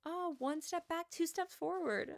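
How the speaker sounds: slow, high, sing-song mockery of the manager. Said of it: "he literally was like".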